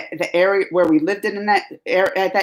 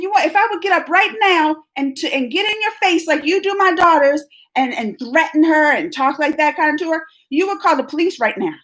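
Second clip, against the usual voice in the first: higher, heated confronting of the teacher; mother reliving her anger at the teacher; retold angry telling-off; 6 semitones higher than usual. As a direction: replay the fury at the meeting. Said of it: "and then I got mad".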